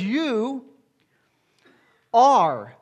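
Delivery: You then are pulled long and hit loud; are pressed as present fact; stress on are. Shown as a demonstration. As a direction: hold You, then land hard on are. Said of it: "not "you will be".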